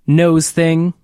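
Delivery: ordinary talk, nothing marked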